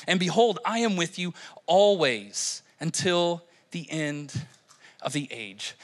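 measured, subdued reading aloud; scripture reading. Real, not acted. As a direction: read the verses solemnly, phrase by phrase.

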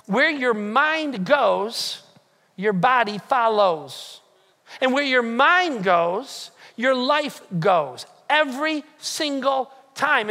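very slow, each phrase weighted; slow emphatic maxim. Acted. Preacher to friends, slow weighty maxim. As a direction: let each phrase sink in slowly. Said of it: "slow emphatic teaching maxim".

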